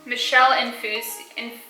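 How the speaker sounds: normal talking voice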